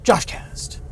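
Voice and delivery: breathy whisper of the show name; whispered show title. Acted.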